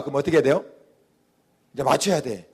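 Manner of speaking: ordinary talk, nothing marked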